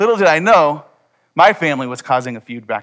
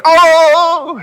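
much higher, louder amazed "Oh!"; awed gasp; 10 dB louder than usual. Retold or imitated. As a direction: kids blown away by their big brother. Brothers amazed at the hero.

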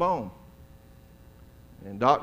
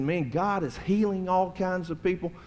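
a touch higher, gushing with excitement; excited greeter; 6 semitones higher than usual. Retold or imitated. Greeter telling the pastor about healings, excited. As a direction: gush about it like an excited college kid. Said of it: "he was just all excited".

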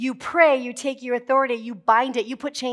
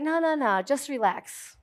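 soothing God voice; 4 dB quieter than usual. Retold or imitated. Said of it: "And God's like".

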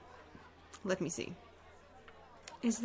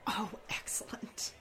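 hushed aside; 7 dB quieter than usual. Acted. Player reacts to overheard guard.

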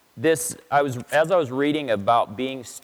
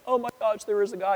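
amazed lady; 8 semitones higher than usual. Retold or imitated. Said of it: "this lady's like".

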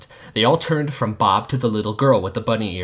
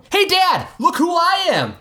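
put-on much higher excited kid voice; boy yelling to his dad; kid shouting. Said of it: "Jean shouted".

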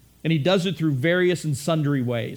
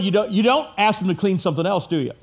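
ordinary delivery

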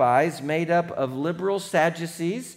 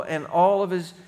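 ordinary delivery

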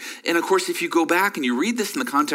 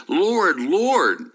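both "Lord" loud, falling; pleading double call; 6 semitones higher, 4 dB louder than usual. Shown as a demonstration. Pastor acting a believer pleading with God, loudly. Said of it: "is this person pleading with God".

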